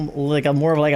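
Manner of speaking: ordinary talk, nothing marked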